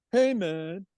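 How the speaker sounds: high laid-back hippie drawl; be the mellow old hippie, man. Retold or imitated. Old friend on phone, high mellow hippie voice. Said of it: "in his best hippie voice".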